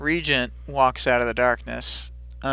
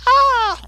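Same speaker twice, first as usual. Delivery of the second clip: loud, very high held wail; imitated cry of someone left dangling; sustained cartoonish squeal; high mock scream; 8 dB louder than usual.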